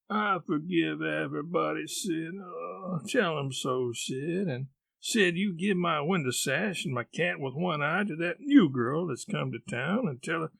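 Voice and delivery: fading, groaning last wishes; feigned deathbed. Acted. Tom plays dying, last bequests.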